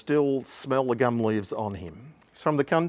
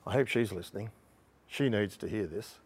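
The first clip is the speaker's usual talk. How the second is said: mock aside; 3 semitones lower, 10 dB quieter than usual. Joking aside about unnamed woman.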